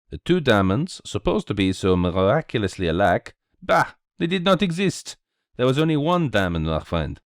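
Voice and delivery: put-on animated French-accented sleuth; Belgian detective, triumphant. Detective reveals the swindle to his friend, triumphant.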